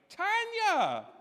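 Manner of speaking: high, loud cry of a name; excited shout of recognition. Retold or imitated. Wife suddenly recognising an old friend, high excited shout. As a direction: call out the name with sudden delight.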